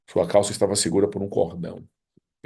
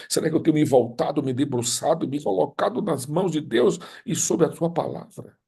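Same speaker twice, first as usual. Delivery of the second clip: impassioned preaching; 5 semitones higher than usual. Real, not acted. Pastor pressing the congregation about their hearts, impassioned preaching. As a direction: build the plea to the congregation. Preacher rising to full force.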